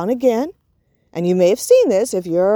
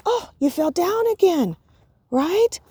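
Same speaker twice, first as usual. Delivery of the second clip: put-on higher, bright cheering on a fallen child; upbeat encouragement; 6 semitones higher than usual. Shown as a demonstration. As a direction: cheer him to try again. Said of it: "Or you could look at him and say".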